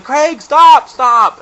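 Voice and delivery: high, very loud shout; imitated yelling. Friend yelling at an out-of-control skier, loud imitated shout. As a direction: yell it as an urgent call down the slope. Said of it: "I would hear him yelling".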